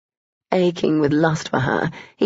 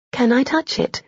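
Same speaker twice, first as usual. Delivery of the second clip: higher female voice; 7 semitones higher than usual. A narrator's reading. Heroine asks shyly.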